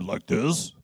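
low, quiet voice of authority; ponderous. Acted. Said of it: "you must speak more ponderous".

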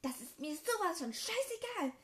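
high, loud outburst; furious yell. Acted. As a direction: spit it back at her. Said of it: "schrie er".

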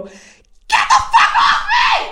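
full-out high scream, words drawn out; enraged scream. Retold or imitated. Shoving off an attacker in sudden rage, screaming. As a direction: explode and scream it slowly. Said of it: "have, like, this rage".

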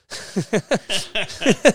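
loud burst of laughter; big laugh. Real, not acted. Laughing after punchline.

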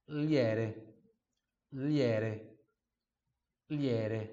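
liere with a clear L and a tapped R; second half drill. Shown as a demonstration. Teacher drilling the second half, liere. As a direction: drill liere slowly and evenly. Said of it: "depois treina a outra parte".

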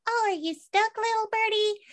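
put-on high, sing-song baby talk to a little bird; mock-sweet high voice talking to a bird; cooing at a birdie. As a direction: coo at it like a pet.

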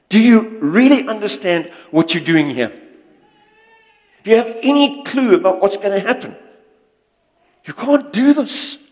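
builds from a sharp jab to a high, loud scolding; indignant rebuke. Retold or imitated. Preacher voicing David scolding the giant, high and loud. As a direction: rise into an outraged, disbelieving telling-off.

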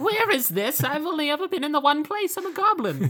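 confused little goblin, squeaking; lost bone goblin baffled by the portal; squeaky goblin. Retold or imitated. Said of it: "You're like".

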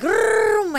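high revving vroom; imitated engine rev. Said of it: "I was like".

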